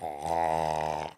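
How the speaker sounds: low, soft mock groan of the paralyzed uncle; lightly acted low moan. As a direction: groan it low like a man who can't talk. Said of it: "And then I'll be like".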